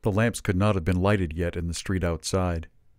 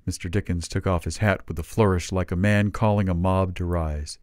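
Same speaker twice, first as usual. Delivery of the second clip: usual speaking voice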